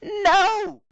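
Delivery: high loud shriek of no; terrified scream. A narrator's reading. Rarity is dragged into the dark, screaming. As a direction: scream as you're dragged away.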